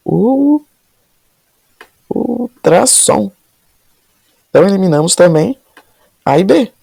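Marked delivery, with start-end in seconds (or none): 0.1-3.3 ultrassom slowly stretched, syllable by syllable; syllables drawn out. Shown as a demonstration. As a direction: draw out U- then ultrassom. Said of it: "A gente divide aqui, ó". (ultrassom dividido, bem devagar)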